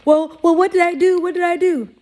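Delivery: high, loud mock innocent whine; playing dumb. Imitating someone playing dumb.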